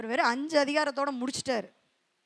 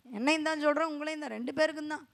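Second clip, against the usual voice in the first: ordinary talk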